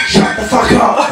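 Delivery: slow, high, put-on snarl; mock retort. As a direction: fire it back as a mock put-down. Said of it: "It will go something like this".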